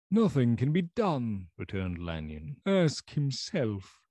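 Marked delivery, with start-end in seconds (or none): 0.1-1.5 put-on doomed old doctor. Lanyon refuses to discuss Jekyll. 2.6-4.0 put-on doomed old doctor. Lanyon refuses to discuss Jekyll.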